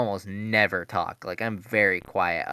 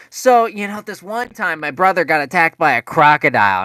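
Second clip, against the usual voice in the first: much higher, louder rambling teacher; mock chatty teacher; 8 semitones higher, 10 dB louder than usual. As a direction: ham up a chatty substitute's story. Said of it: "they start going".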